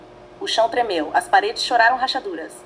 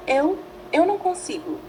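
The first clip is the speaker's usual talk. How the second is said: swallowing hard; 7 semitones higher than usual. A narrator's reading. Ichigo in Rukia's body admitting his fear, faltering and higher. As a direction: choke the words out nervously. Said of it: "engolindo em seco".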